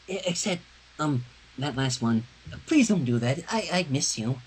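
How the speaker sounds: gentle, timid reassurance to a friend; shy friend's voice. Acted. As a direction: speak it shyly, as if afraid to intrude.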